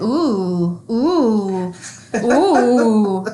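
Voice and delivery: mock the cashier with a rising ooh; teasing ooh that builds into a laugh; mock impressed ooh. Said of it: "I'm like".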